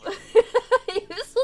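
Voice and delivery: slow, high, loud laugh into the word. Real, not acted. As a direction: drag it out through laughter. Player laughing at character.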